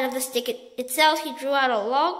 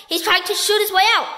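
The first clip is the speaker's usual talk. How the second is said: alarmed shout; 6 semitones higher, 5 dB louder than usual. A narrator's reading. Duke still shouting.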